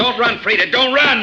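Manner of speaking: high, loud shout with a laugh; taunting yell as she runs; mocking shout. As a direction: yell after her, half laughing. Bodine laughing, chasing her.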